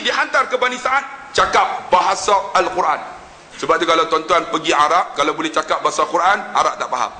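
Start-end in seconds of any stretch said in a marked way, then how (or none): none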